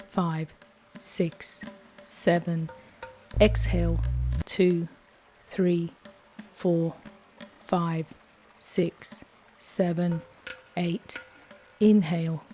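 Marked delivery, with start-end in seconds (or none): none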